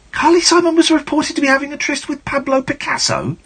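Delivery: very high, squeaky disbelief; mock incredulity. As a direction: squeal the absurd claim in disbelief. Mock disbelief at absurd biography claim.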